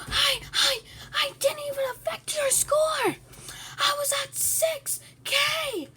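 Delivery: high, loud screaming rage; real furious screaming. Real, not acted. Eaten while small, screaming at the bigger player. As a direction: scream it in real fury, voice cracking.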